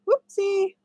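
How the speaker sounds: high, slow mock oops; playful whoopsie. Real, not acted. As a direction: laugh off the slip with a high oops. Mistake in the stitch.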